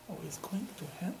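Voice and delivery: breathy whispered ending, almost no voice. Retold or imitated. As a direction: drop to a whisper on the last words. Quote of the rabbi's fear.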